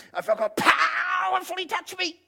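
very high, wailing rapture; hammed-up tearful cry of being touched by God; mock religious ecstasy. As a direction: stretch "powerfully" into a high mock wail. Mocks an overexcited anointed believer.

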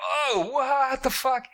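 hammed-up shocked Travis; horrified disbelief. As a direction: stretch it in mock horror. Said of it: "And he's like".

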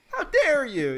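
high, loud mock outrage; offended Italian listener. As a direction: play the insulted fan, hammed-up. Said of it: "He's like".

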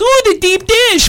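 high, slow, gushing aunt voice; imitating the aunt. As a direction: coo it like a doting aunt. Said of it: "our aunt comes into town and goes".

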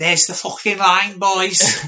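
loud, put-on lad calling out; mock lad voice. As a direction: call it out like a lad.

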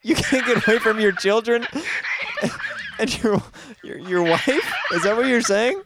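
talking through high-pitched laughing; breaking up laughing mid-question; helpless laughter. Real, not acted. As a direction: struggle to get the question out while cracking up. Host cracking up at crying caller.

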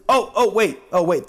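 normal talking voice

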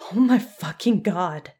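loud, breath-laden shock; horrified exclamation. A narrator's reading. Jan backing away from the wriggling gauze, horrified exclamation.

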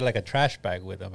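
ordinary talk, nothing marked

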